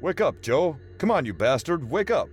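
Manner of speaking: urgent shouting. Acted. O'Sullivan rouses Joe urgently.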